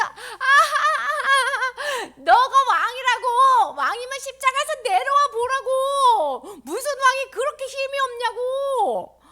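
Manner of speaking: high, loud, drawn-out taunting; mocking jeer. Crowd below the cross mocking Jesus, loud jeering. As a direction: jeer at full voice after a hammed-up laugh. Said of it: "그러면서 막 놀리면서".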